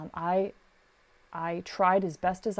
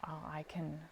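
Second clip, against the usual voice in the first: whisper; 3 semitones lower, 13 dB quieter than usual. Retold or imitated. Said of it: "I'm like".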